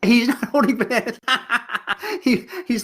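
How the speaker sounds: high-pitched talk broken by real laughter; real amused laughter in the words; laughing. Real, not acted. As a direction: say it through giggles. Laughing at student's remark about picture.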